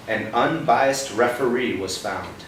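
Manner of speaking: dramatic bedtime-story reading; storybook reading. Acted. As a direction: spell out each phrase for a child. Reading picture book to child.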